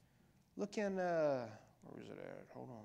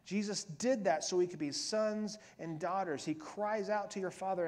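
ordinary delivery

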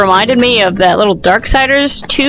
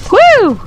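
high 'woo' swooping up and down; short high cheer; excited whoop; 9 semitones higher, 3 dB louder than usual. Real, not acted. Player landing a wall run, excited whoop.

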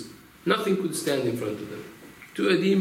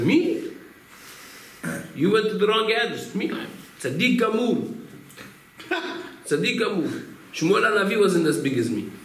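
much higher, indignant bragging; mock-outraged boast of greatness; boastful denial; 7 semitones higher than usual. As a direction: puff up like an offended braggart. Boastful shochet rejects the rabbi.

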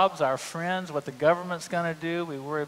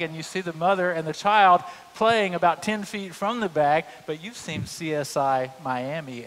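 normal talking voice

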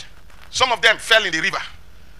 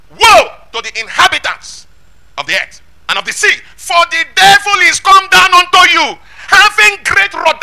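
loud, slow declamation climbing high; thundering pulpit reading; forceful scripture proclamation; 9 semitones higher, 9 dB louder, slower than usual. Acted. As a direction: cry out the warning with every word stretched.

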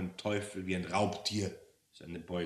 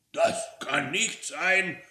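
slow, raised, disguised voice; forced higher voice, drawn out; trying to sound higher; 9 semitones higher, 7 dB louder, slower than usual. Acted. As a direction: pitch it up to hide who you are. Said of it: "etwas höher zu klingen".

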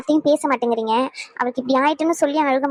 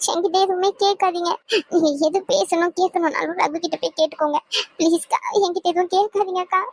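higher, sobbing plea to be left alone; tearful pleading; 4 semitones higher than usual. Acted. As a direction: beg through tears not to be questioned. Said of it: "இப்படி அழுதுகிட்டே இருக்கிறதுனால".

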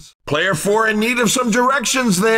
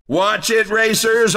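ordinary talk

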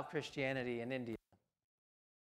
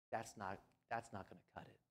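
quiet stern God voice; 9 semitones lower, 15 dB quieter than usual. Retold or imitated. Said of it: "God says".

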